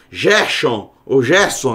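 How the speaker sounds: "Gerson" with zh at the start, as "Zhérshon" or "Zhérson"; Portuguese soft G. Shown as a demonstration. Teacher listing wrong Portuguese readings, g as zh. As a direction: soften each g to a Portuguese j. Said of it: "como no português".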